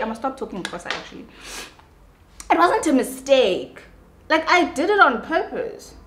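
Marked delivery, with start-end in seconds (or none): 2.5-5.9 high, emphatic; worked-up repeat; exasperated insistence. Real, not acted. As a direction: insist it was on purpose. Insisting she did it deliberately.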